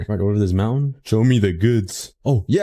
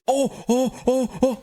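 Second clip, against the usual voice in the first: high, frantic yelps while drowning; panicked yelps; 3 dB quieter, slower than usual. Real, not acted. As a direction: yelp as the water takes you.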